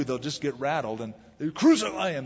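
usual speaking voice

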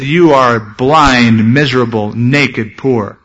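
very slow, falling, weighty words; solemn verdict. Acted. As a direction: pronounce each word like a judgment. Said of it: "And Jesus said".

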